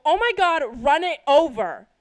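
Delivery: very loud, high-pitched exclamation; urgent yell. Retold or imitated. Daughter yelling at dad about the squirrel, urgent yell. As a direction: yell it like an order to the driver.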